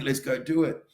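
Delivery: euphoric rush. Acted. Speaker acting out the drug-like rush, euphoric. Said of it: "where I feel euphoric and great".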